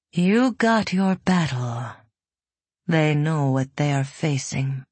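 commanding, otherworldly woman; a calm saint restraining him. Acted. Voices Elizaveta.

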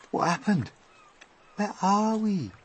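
high, startled questions; high, uneasy wondering; bewildered. A narrator's reading. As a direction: ask in bewilderment as you peer around. Bewildered travellers emerging on peach.

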